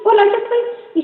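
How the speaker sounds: vocecita muy aguda de niña; high, tiny little voice. Acted. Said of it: "llega con una vocecita así". (vocecita aguda y pequeña)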